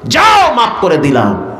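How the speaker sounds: ringing burst on যাও, then falling; proclaimed pardon. Acted. God tells the angels to forgive, ringing proclamation. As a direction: proclaim it with a long, high যাও.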